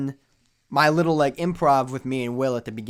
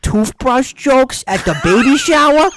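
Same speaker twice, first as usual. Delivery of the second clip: much higher, louder mock outrage; indignant family; 5 semitones higher, 7 dB louder than usual. The grandparents complain about the uncle's jokes, mock outraged. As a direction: rant like a scandalised grandparent. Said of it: "the family's so like".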